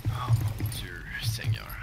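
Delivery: teasing stage whisper; playful whisper. Pretend. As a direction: whisper it like a sly greeting.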